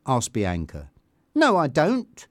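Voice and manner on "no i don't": higher, defensive denial; flustered little protest; quick denial. A narrator's reading. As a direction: deny it straight away. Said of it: "said Tanya quickly".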